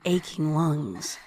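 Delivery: usual speaking voice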